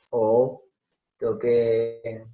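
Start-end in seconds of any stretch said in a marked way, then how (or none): none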